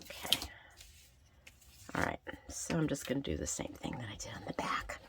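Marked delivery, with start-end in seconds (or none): 1.9-5.0 whispering. Real, not acted. Quietly talking to herself while crafting.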